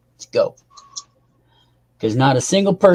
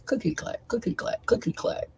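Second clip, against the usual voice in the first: fading into a whisper; whispered train sounds; 8 semitones higher, 12 dB quieter than usual. Acted. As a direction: let it fade to an unvoiced whisper.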